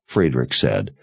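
plain reading voice

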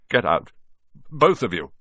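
loud, croaked order; croaking. A narrator's reading. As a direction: croak it out in shock. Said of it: "croaked Uncle Vernon".

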